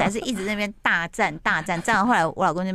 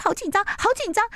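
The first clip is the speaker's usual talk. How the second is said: mock nervous; 13 semitones higher than usual. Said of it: "他说".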